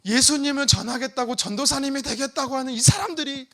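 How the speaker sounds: arguing with God. Retold or imitated. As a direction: demand answers from God, voice lifted. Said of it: "이러면서 제가 하나님께 따지면서".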